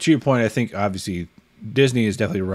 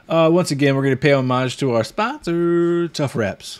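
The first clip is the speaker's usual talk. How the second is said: ad read; 5 semitones higher, 4 dB louder than usual. Acted. Sponsor read.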